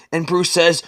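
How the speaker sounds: usual speaking voice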